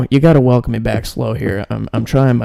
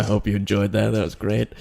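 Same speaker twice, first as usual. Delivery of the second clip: usual speaking voice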